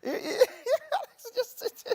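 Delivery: high-pitched laughing fit; laughing hard. Real, not acted. Mother laughing about her French kids, high laughter. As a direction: break into high giggles while talking.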